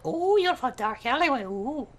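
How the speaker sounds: soft, low musing; hushed relish. Pretend. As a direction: murmur appreciatively to yourself. Character savours dark alleyway.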